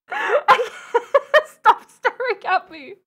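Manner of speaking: high, loud, cracking up between words; embarrassed laughing plea. Real, not acted. Telling viewers to stop staring, laughing loud and high. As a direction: beg through helpless laughter.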